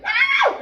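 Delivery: sudden high, loud yell of release; shrill scream of release; high yelp shout. Retold or imitated. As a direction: let out a piercing cry. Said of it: "everybody went".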